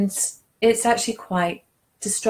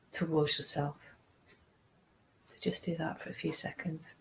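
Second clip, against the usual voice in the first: softer and softer, dropping to near whisper; guided meditation; 6 dB quieter than usual. Acted. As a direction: ease them inward, gently. Guides second imagining exercise.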